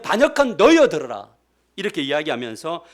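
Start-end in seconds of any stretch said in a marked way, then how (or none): 0.0-1.3 shouted high and much louder, 너희여 falling hard; furious shout. Retold or imitated. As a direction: bellow the rebuke at the people. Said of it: "성도들에게 뭐라고 하냐면". (화가 나서 크게 외치는 모세)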